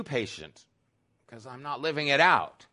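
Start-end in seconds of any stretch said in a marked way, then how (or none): none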